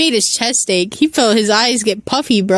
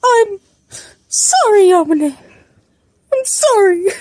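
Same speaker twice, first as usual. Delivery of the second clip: high, halting mock sob; hammed-up tearful wail; crying apology; 10 semitones higher than usual. A narrator's reading. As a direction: plead it high, word by word. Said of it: "realized he was crying".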